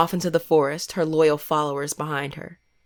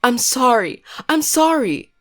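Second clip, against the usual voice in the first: hysterical pleading; 6 semitones higher than usual. A narrator's reading. Panicked girl begging the statue, crying high. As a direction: beg for mercy in panic. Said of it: "horrified and hysterical".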